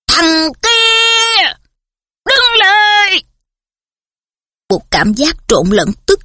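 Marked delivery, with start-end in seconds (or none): 0.1-3.2 high, booming angry shout; thundering bellow. A narrator's reading. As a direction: bark the order like thunder. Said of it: "tiếng quát của dượng Vernon nổ ra như sấm".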